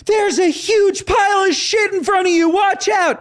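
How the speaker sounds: high-pitched, hysterical warning shout; imitated scream. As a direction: mimic a panicked stranger shrieking. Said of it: "looks at me and screams".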